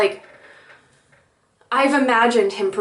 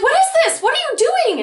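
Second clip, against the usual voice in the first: very high, flustered questioning; retold panic-excitement, pitch shooting way up; freaking out; 3 dB louder than usual. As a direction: squeal the questions in mock alarm. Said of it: "and I was freaking out".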